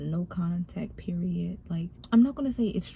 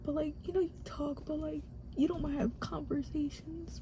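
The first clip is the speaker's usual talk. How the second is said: higher, soft voice on the verge of crying; real tearful; 5 semitones higher, 5 dB quieter than usual. Real, not acted. Speaker confides childhood loneliness with mom not talking, tearful. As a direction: hold back real tears while confiding. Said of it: "I'm about to cry".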